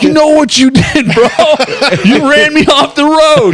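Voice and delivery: very high, loud mock shouting; acted accusing yell. Liar joins in accusing the stranger, acted high-pitched yelling. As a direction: yell it out, reenacting false outrage. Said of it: "And I join in".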